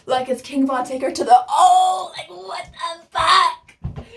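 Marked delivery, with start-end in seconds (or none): 1.5-4.0 crying out in disbelief, voice cracking high; stunned shout of disbelief; shocked outburst. Real, not acted. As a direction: yelp it out like you can't believe it. Shock at the boy's question.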